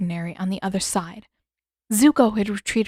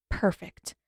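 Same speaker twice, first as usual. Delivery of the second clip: ordinary delivery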